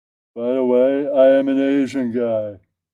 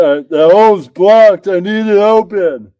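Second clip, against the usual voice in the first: loud, high and slowed on the blocked hole; yelling it like a frustrated complaint; mock yell; 6 semitones higher, 8 dB louder than usual. Yelling at the girlfriend in bed, mock frantic. Acting out himself in the threesome.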